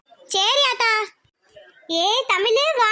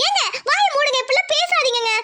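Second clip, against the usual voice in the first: alarmed scolding; 4 semitones higher than usual. Acted. She scolds his death talk.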